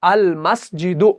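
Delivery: अल मस्जिदु read slowly, each sound clear; slow demo reading. Shown as a demonstration. Teacher demonstrating अल मस्जिदु slowly to students. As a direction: model अल मस्जिदु with the lam held still. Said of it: "तो ये बनेगा".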